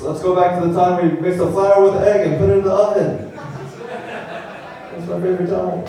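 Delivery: lightly voiced nostalgic complainer; whiny cake hater. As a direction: pout about the good old days. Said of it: "That's like being like".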